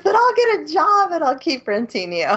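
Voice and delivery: high, loud eager vow; mock suitor. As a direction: vow it like a smitten idiot. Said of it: "He goes".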